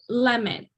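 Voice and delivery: "Lemon." with LEM stressed and "mon" reduced to a schwa; "Lemon." said as LEM-ən, stress up front. Shown as a demonstration. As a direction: hit LEM clearly, then a falling, weak "mən". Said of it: "You hear the emphasis in the lem part".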